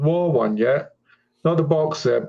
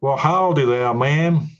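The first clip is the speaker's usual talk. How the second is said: amateur American cowboy greeting; put-on American howdy, drawn out; attempted American accent; slower than usual. Shown as a demonstration. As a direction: try on a folksy American accent. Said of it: "let's try this American accent".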